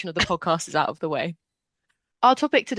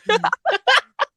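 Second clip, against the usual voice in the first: high, loud mock evil laugh; high villain laugh; 5 dB louder than usual. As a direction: cackle like a horror host. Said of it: "Just kidding".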